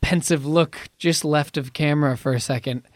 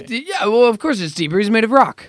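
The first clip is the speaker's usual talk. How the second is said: mock indignation; 6 semitones higher, 6 dB louder than usual. Real, not acted. Mock-indignant defence of deep voice.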